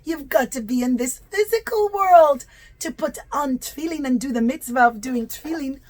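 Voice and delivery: ordinary delivery, nothing marked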